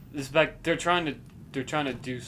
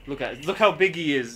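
raised and eager; excited; 4 semitones higher, 9 dB louder than usual. Real, not acted. Excited at the huge figure.

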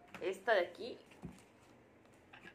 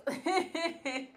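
giggle; 7 semitones higher than usual. Real, not acted. Laughs about scratching her head.

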